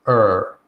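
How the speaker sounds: held "er" with rounded lips; er sound demo. Shown as a demonstration. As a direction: sound out a long "er" for learners. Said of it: "It looks like this".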